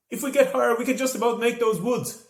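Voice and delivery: shouted. A narrator's reading. Aisling to Joe over the wind, loud shout. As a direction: call out the plan. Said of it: "she shouted".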